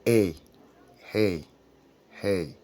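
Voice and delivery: "hey" said as English A, like "ay" in day; English letter A. Shown as a demonstration. Teacher demonstrates English A to French learners. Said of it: "et en anglais c'est".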